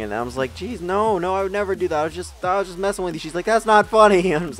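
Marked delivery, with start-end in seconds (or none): 0.5-3.2 higher-pitched, hurried protest; lightly acted anxious excuse; mock defensive pleading. Retold kid pleading with frightened mom. 3.5-4.3 high, loud indignant retort; hammed-up motherly outrage; mock scolding. Retold mom scolding her child.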